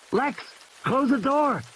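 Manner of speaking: put-on high, pressing young boy's order; urgent boy. Tim orders Lex to close the door. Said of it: "Tim shouts at his sister".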